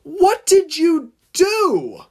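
high, loud, drawn-out cry of horror; appalled, high-pitched slow exclamation; horrified gasp. A narrator's reading. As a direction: cry out aghast, stretching each word. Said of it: "gasped the tank engine in horror".

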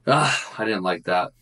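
ordinary talk

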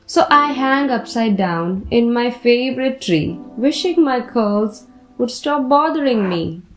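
ordinary delivery

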